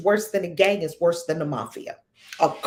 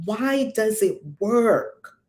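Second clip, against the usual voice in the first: ordinary talk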